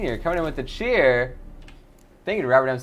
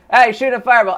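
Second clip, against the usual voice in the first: shout; 8 semitones higher, 10 dB louder than usual. Acted. Rat attacked in game.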